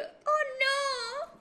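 very high, wailing dismay; mock dread. Daughter learns dad knows about boyfriend, mock dread. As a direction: wail it high in comic panic. Hija se entera de que está descubierta.

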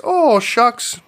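hammed-up Fargo local, pitched high; folksy mock Fargo voice; Fargo accent. Podcaster playing two polite fishermen for laughs, high mock Fargo accent. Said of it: "I turned him into Fargo people".

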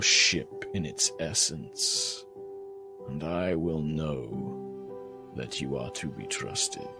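low, slow god voice like dying embers; crackling ember voice. Acted. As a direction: speak slowly and low, like a fading fire. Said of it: "this crackling voice like embers of a dying fire".

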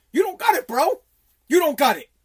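hammed-up disbelieving shout; retold shout. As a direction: bark it up high like he's calling them out. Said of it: "I'm like".

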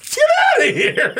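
laughing. Retold or imitated. Security guard ordering out.

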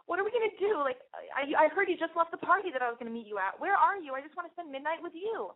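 lightly voiced worried girlfriend; anxious girlfriend. Girlfriend on the phone begging to meet at midnight, fretful. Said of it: "she's like".

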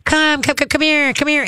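high sing-song come here to the dog; high coaxing call. Shown as a demonstration. Trainer showing owner how to call the dog, high and coaxing. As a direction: coax the dog in with a bright high voice. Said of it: "And at the same time, go".

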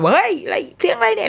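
high-pitched retold yelp of alarm; startled shout. Parents shouting after a rear-end bump, startled. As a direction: call out in sudden surprise, pitch jumping up. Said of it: "พ่อแม่ที่แบบตะโกนขึ้นมา".

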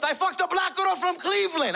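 high-pitched, panicked father blurting. Retold or imitated. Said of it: "Then he panicked more".

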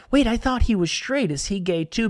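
sudden realisation, pitch jumping up; surprised. Retold or imitated.

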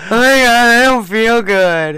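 slow, whiny sick-husband voice; mock sick whine. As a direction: drag it out like a pitiful sick man. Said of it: "when I get sick and stuff".

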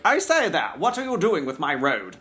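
high, plummy toff voice; posh old gentleman. Retold or imitated. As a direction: play the landed old gent scolding. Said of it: "I did like the old moneyed landed gentleman guy".

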